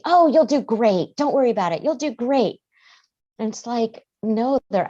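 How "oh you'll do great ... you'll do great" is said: higher, louder breezy brush-off; mock cheerful parent waving the worry away; dismissive reassurance. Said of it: "And we say".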